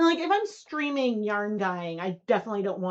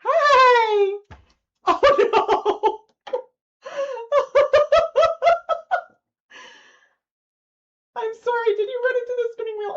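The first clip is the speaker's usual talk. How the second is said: much higher, louder squealing coos to the dog; pet talk; 14 semitones higher, 10 dB louder than usual. Real, not acted. Greeting the old dog.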